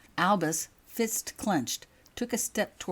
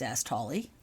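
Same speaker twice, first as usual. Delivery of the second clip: ordinary delivery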